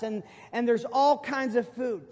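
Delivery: normal talking voice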